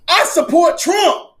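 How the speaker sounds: high, slow mock celebrity endorsement. Mocking a rapper's endorsement, high and slow. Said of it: "get [name] to say".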